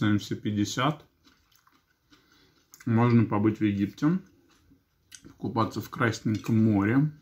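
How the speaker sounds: chewing between words; eating salad while speaking; talking with mouth full. Real, not acted. Seen 2: Forkfuls of salad go to the mouth; cheeks bulge while talking.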